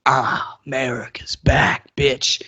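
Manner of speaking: gravelly-free low swagger, fading to a whisper on the last word; a mock triumphant governor drawling the line; half-whispered mock victory line; DeSantis voice.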